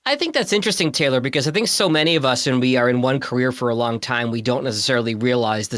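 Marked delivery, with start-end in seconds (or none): none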